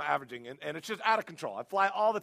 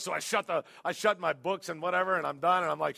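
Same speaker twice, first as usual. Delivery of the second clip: normal talking voice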